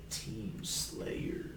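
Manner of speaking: slow, whispered game announcer; whispering announcer. Acted. Imitating Halo announcer.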